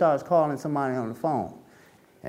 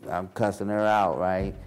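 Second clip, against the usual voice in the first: ordinary talk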